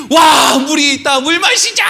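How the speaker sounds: high, drawn-out whoop of discovery; elated mock shout; excited crowd cry. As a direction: cry out like you've finally found water. Acts out thirsty crowd finding water.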